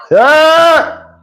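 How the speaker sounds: high, drawn-out "Ah!"; mock shout. Storyteller reacts to her kiss-my-ass move, mock shouting. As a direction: let out a long high holler. Exclaims at the retold insult.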